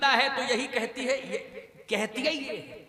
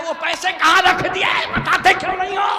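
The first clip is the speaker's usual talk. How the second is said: high, loud demanding shout, hammed up; son's mock yelling; 7 semitones higher, 11 dB louder than usual. Son at deathbed demands the money, exaggerated shout. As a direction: yell it like an impatient son. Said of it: "और लड़का बगल में खड़ा".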